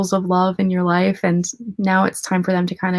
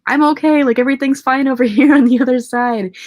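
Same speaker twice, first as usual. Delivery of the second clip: higher, louder happy dog voice; dog in a dream; 6 semitones higher, 6 dB louder than usual. Retold or imitated. Her late dog reassuring her from the afterlife, bright and higher. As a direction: chirp it out like a cheerful pup.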